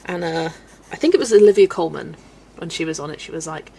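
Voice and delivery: normal talking voice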